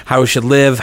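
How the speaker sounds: usual speaking voice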